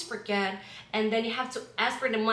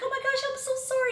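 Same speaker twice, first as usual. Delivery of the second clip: high, fast apology to a hurt pet; apologizing to pet; 13 semitones higher, much faster than usual. Retold or imitated. Apologizing to the pet you stepped on, high and fast. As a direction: squeak out a sorry to the pet, much higher. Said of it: "And you're like".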